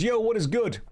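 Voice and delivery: light mock American accent; imitating a player's greeting. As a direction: toss off a cool street greeting. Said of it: "My accents".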